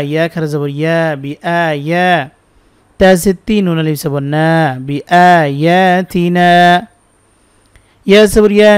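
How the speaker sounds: normal talking voice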